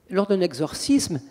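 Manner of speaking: ordinary delivery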